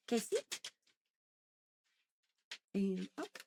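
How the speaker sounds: soft cooing dog-praise voice; talking to a dog. Real, not acted. Talking to dogs during treats.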